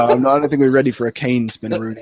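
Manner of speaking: ordinary talk, nothing marked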